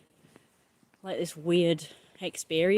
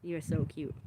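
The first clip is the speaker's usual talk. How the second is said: ordinary talk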